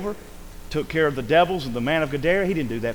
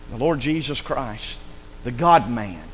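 ordinary talk

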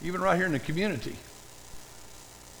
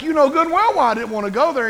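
higher, louder indignant retort; indignant Jonah; 8 semitones higher, 6 dB louder, faster than usual. Retold or imitated. Jonah arguing with God, a higher, louder indignant retort. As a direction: throw it back at God, offended.

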